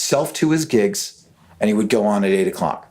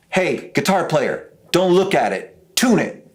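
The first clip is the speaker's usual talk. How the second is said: rising, sharper order to stop looking and tune; a commanding bandleader, pitched up; impatient command; 6 semitones higher than usual. Retold or imitated. [name] scolding him.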